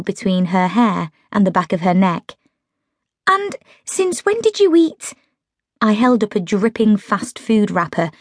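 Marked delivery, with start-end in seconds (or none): 3.3-5.1 higher, lightly voiced friend Meg; Meg's voice. A narrator's reading. Narrator voicing Meg, caught out eating junk. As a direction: voice Meg as a defensive young woman.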